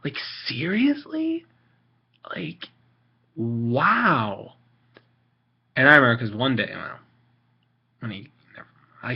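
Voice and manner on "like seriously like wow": slow, high disbelief; incredulous. Real, not acted. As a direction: stretch it out in disbelief. Disbelief at judgmental people.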